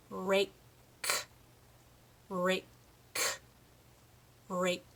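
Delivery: RAKE with the K released apart, each time; slow drill, pop the k ending each rake; clear final K. Shown as a demonstration. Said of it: "putting the end on that word".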